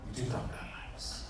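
hushed, almost unvoiced echo; whispered repeat. Shown as a demonstration. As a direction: drop to a breathy whisper on the last repeat. Third repeat, nearly whispered.

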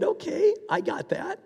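high, laughing okay; laughing reply. Retold or imitated. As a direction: laugh through the words. Replies to the soldier laughing.

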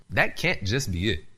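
ordinary delivery

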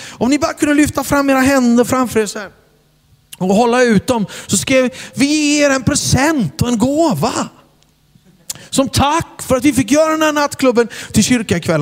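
high, warm host voice; stage invitation. Retold or imitated. Preacher asking the crowd to hold out hands. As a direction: invite them forward gently. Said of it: "Och så sa jag".

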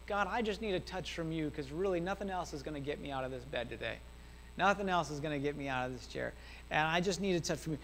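understated, drained plea to God; miserable prayer. Retold or imitated. Depressed man praying from his bed, retold miserable plea. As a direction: plead softly, with a touch of misery. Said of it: "just miserable".

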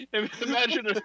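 high-pitched, helpless laughter through the words; laughing hard. Real, not acted. Host cracking up at his failed accent, laughing. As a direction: crack up while trying to talk.

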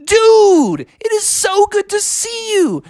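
very high, drawn-out delighted greeting; overjoyed Froggy. Acted. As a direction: cry out to a long-lost friend. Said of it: "And Froggy's like".

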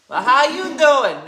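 put-on loud, swooping Jersey accent; hammed-up Jersey come-on, high and loud. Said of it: "Yeah, Jersey".

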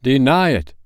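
loud, commanding ghost cry. Acted. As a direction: hurl the command at him. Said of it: "cried the spirit".

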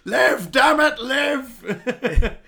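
high, loud hammed-up CPR yell; desperate shout. Pumping the friend's chest, hammed-up loud high shout. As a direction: bellow it over his chest like a TV doctor.

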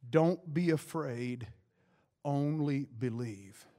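calm him word by word, unhurried; gentle reassurance. Acted. Jesus telling Jairus not to fear, slow gentle reassurance.

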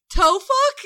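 put-on high, loud little-kid voice mispronouncing truck; toddler voice. Mom imitating her toddler showing a toy truck, high excited kid voice. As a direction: imitate the toddler proudly naming his toy.

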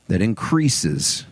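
usual speaking voice

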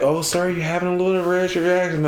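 high, sing-song mock nurse, lightly played; brushing it off like a cheery nurse. Nurses' light reassurance, voiced high.

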